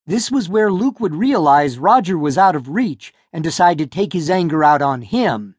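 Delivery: ordinary delivery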